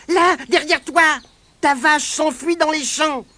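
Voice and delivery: very high, loud tricky voice; leprechaun's call. Acted. Leprechaun tries to distract Pat, very high and loud.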